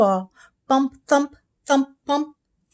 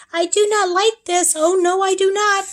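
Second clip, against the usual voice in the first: high, louder fussy fish; 10 semitones higher, 4 dB louder than usual. A narrator's reading. The fish in the pot grumbles, high and louder. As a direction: complain like a wet little fish. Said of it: "He said".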